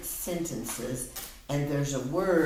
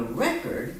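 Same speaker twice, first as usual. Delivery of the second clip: "record" as a noun, REC-ord; stress on first syllable. Shown as a demonstration. Teacher repeating the example sentence, "record" stressed on REC. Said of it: "then I said".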